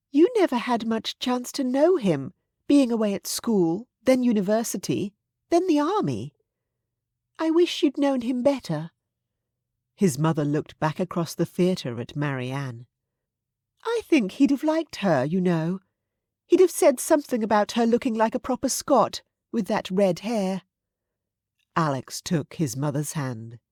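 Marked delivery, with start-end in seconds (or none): 0.1-8.9 high-pitched aristocratic mother, gently regretful; wistful mother. A narrator's reading. Mother voiced, wistful about grandfather. 13.8-20.6 higher-pitched aristocratic mother, chatting fondly. A narrator's reading. Mother voiced, speculating fondly.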